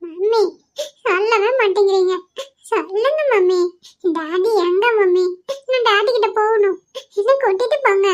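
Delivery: put-on high, sobbing child's whine; tearful pleading. Child begs mother to take him to daddy, crying. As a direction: beg through tears, stretching the words.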